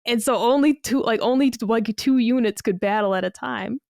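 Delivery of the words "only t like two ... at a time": usual speaking voice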